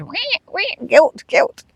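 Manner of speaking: high imitation siren; mum radar alarm. Mum's guilt radar going off, mock siren. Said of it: "my mum radar goes".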